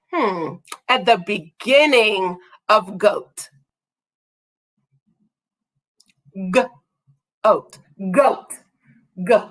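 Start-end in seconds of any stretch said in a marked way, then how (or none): 0.9-7.8 G-oat with the G drawn out and split off; stretched initial G. Shown as a demonstration. Teacher isolating the first sound for kids, G drawn out. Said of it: "What sound do we hear".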